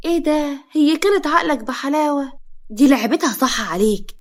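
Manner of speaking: loud, drawn-out resentment; spiteful. A narrator's reading. Mother saying the wife fooled her son, spiteful. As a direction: stretch the accusation bitterly, loud. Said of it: "فوزية بغل".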